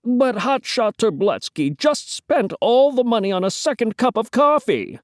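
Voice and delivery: high-pitched character voice; Brian the editor, explaining. Acted.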